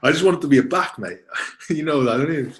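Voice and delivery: laughing reply to the teasing. Real, not acted. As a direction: laugh while protesting.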